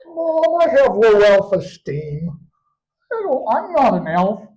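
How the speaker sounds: put-on high, slow whining kid voice; child's whine. Boy telling his mom his diagnosis, high whiny kid voice. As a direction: whine it like a sad little boy.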